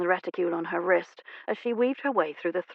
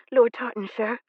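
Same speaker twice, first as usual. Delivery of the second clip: demure; 4 semitones higher than usual. Acted. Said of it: "she said demurely".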